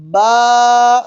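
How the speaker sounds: با drawn out long, the wrong stretched way. Shown as a demonstration. Teacher shows students the wrong way, a stretched با. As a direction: stretch the vowel in با too long on purpose. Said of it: "کھینچنا نہیں ہے".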